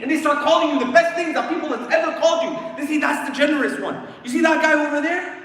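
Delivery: loud, high announcing of praise; excited praise. Acted. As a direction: call it out proudly to everyone. Excited voices praising the dead.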